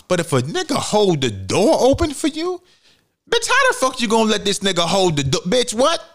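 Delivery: shrill mock fury at a door held open; mock jealous outrage. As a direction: rant like an outraged jealous man. Acting jealous man's outrage.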